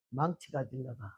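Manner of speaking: husband's flat, low, unhurried answer; low quiet reply. Retold or imitated. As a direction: calmly say he's fetching a hammer. Husband answers calmly, menacingly.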